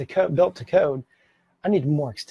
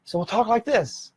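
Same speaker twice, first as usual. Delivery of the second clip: nervous high ending; 6 semitones higher than usual. Shown as a demonstration. Teacher demonstrating a nervous speaker's rising high finish. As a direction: pitch climbs at the end of the sentence. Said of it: "a higher pitch at the end of our sentences".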